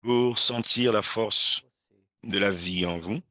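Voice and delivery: slow, gentle prayer guide with long pauses; guided prayer. Acted. Brazilian pastor guiding webinar viewers into silent prayer, slow and gentle. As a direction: ease listeners into stillness before God.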